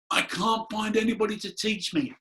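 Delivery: imitating a desperate appeal to spirit; retold frustrated plea. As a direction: beg the empty room for a teacher.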